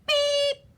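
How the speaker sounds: high, loud horn beep; friendly beep. A narrator's reading. Reading to a child as little blue truck, friendly beep. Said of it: "said the friendly little blue truck".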